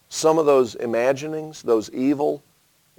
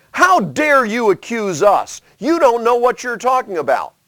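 indignant retort; 10 semitones higher, 5 dB louder than usual. Retold or imitated. Accused tribes defending themselves, higher indignant retort. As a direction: bristle at the accusation. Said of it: "the other folks didn't respond".

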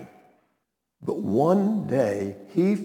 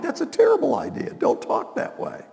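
mock Peter; 13 semitones higher than usual. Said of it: "Peter was like".

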